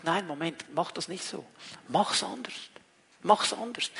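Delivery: a soft, quiet nudge: "Mach's anders"; hushed voice of the Spirit; gentle inner voice. Pretend. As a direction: speak it softly, like a quiet inner prompt. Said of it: "diese kleinen Impulse".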